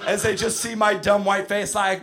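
usual speaking voice

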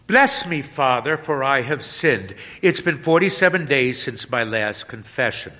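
put-on a teenager opening confession; young penitent.

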